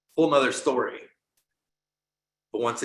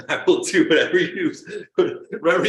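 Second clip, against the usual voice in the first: louder, higher bargaining through laughter; laughing mock plea; 5 semitones higher, 7 dB louder than usual. Player praying to God to get him out of this, laughing mock plea. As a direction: plead half-laughing, as if bargaining desperately.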